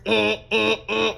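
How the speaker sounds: honked warning buzzer noises; short repeated siren blasts; alarm siren imitation. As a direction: sound the alarm with your voice.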